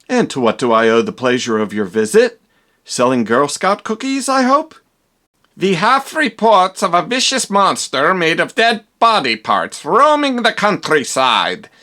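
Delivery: put-on a stern inspector with a German accent. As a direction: announce yourself like a stiff German police inspector. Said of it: "I am Inspector Kemp".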